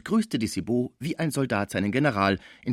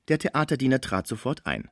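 ordinary delivery, nothing marked

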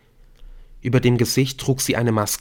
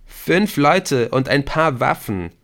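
higher, slow, dismayed groan; 5 semitones higher, 4 dB louder, slower than usual. A narrator's reading. Viktor hears how many armed people remain, groaning. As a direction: moan at the bad odds. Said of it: "stöhnte Viktor".

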